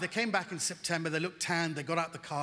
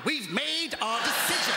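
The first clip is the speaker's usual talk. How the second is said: slow stubborn refrain, pitched higher; a hammed-up defiant Brit; stubborn, rising; 4 semitones higher, slower than usual. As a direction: insist even as doom nears, higher and slower. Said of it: "We could all die".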